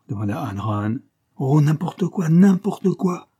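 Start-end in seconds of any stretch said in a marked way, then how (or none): 1.4-3.3 loud, high, exasperated bark. A narrator's reading. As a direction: bark it impatiently, louder on the repeat. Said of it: "aboya Parr".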